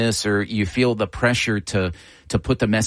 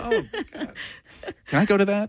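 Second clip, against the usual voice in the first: delighted; 12 semitones higher, faster than usual. Real, not acted. Host delighted by the voice.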